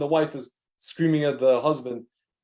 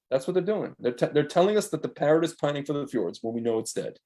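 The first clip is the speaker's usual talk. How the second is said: ordinary talk, nothing marked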